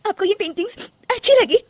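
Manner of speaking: high, disbelieving question; wife stunned that her mother-in-law likes her paintings; surprised hope. Acted. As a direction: ask with sudden hope.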